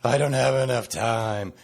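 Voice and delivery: whiny mock complaint; whiny complainer's moan; complainy pants voice. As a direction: moan it like a grumbler. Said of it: "I'm gonna use my complainy pants voice".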